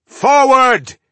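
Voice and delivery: high, very loud battle cry; frenetic yell. Acted. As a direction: yell the charge at full voice. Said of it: "he yelled frenetically".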